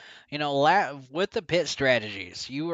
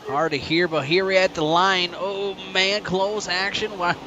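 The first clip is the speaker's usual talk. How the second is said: excited play-by-play building to the finish; live race call; 4 semitones higher than usual. Real, not acted. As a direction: call the final laps with rising hype.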